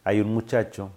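ordinary delivery

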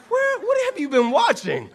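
high, loud, laughing surprise; laughing disbelief. Retold or imitated. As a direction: burst out in amused disbelief.

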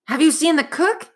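put-on boy begging for news of the cook; desperate. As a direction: ask it in a rush of worry. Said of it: "asked Jack desperately".

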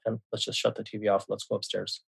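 quietly steer him away, as retold; imitating a parent defusing things under the breath; hushed retold reassurance. Calming son after mother's outburst.

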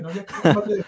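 high, loud mock outburst with laughter; acted "calm down" protest; exasperated telling-off. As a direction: act out a fed-up kid, half laughing. Acts out telling off classmate.

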